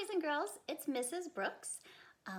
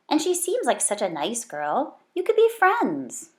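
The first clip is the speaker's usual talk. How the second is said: mother's voice; 2 semitones higher, 16 dB louder than usual. Acted. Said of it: "said her mother".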